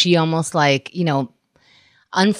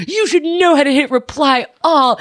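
exasperated scolding; 7 semitones higher than usual. Retold or imitated. Imagined rant at mother.

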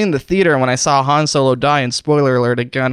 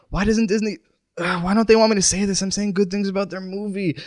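high, softer wounded complaint; pained pleading; 5 semitones higher, 5 dB quieter than usual. Pretend. Poisoned host asking why Disney stops him talking, plaintive. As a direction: plead through the pain at an unfair punishment.

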